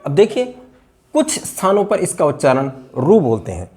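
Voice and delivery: ordinary delivery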